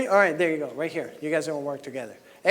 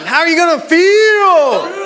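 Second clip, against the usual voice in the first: louder, higher rallying shout; fired-up shout; 4 semitones higher, 5 dB louder than usual. Shown as a demonstration. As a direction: pump the room up with this question. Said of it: "Come on".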